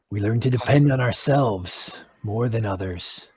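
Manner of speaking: low, soft grave druid explaining; solemn druid. Acted. Druid explaining the Enclave to new members, low and grave. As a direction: lay out the creed with quiet gravity.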